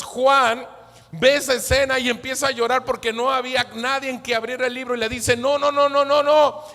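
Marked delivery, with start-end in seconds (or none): 5.3-6.6 high, insistent string of refusals; emphatic elder. Acted. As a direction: cut off the weeping with loud, raised insistence. Elder acted reassuring weeping Juan.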